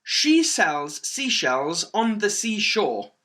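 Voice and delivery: slow, carefully separated words; demonstrated tongue twister. Shown as a demonstration. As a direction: present the tongue twister slowly and clearly. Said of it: "The next one is".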